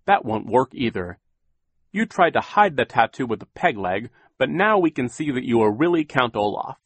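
stern official laying out facts; Mister Poe voice. A narrator's reading. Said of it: "Mister Poe said again".

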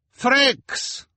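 high shrill-free carnival boss call; Madame Lulu crying out. A narrator's reading. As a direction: call out loud as the fortune-teller. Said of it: "Madame Lulu cried".

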